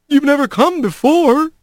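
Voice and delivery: high-pitched disbelief; amazed. A narrator's reading. Doorkeeper stunned that Rat has come, amazed. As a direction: pitch lifted in astonishment.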